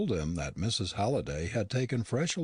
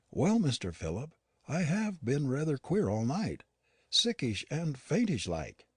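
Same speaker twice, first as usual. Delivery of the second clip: higher-voiced, worried old servant; lightly voiced housekeeper, uneasy and unwell; Yorkshire housekeeper Nancy; 7 semitones higher than usual. A narrator's reading.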